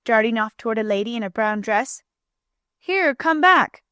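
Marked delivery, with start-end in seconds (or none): 2.8-3.8 high, stretched-out call; calling out in alarm, higher-pitched; urgent cry. A narrator's reading. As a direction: call after a child running off. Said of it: "cried Bunker".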